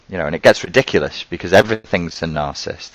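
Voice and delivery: ordinary talk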